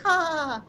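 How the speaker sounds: very high, held mock cartoon laugh; bad SpongeBob laugh. Actor demonstrates the laugh from their audition, badly faked cartoon laugh. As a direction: fake a high-pitched character laugh. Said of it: "when I had to laugh".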